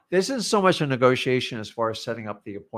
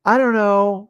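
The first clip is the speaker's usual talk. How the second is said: higher, lightly acted noncommittal reply; mock indecision; 7 semitones higher, 3 dB louder, slower than usual. Partner asked where to go eat, mock indecisive. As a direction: play a partner who won't choose.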